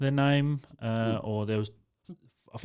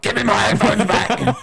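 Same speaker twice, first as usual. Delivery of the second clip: higher, louder demand through laughter; mock-indignant laughing demand; 8 semitones higher, 6 dB louder than usual. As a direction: demand them back, giggling.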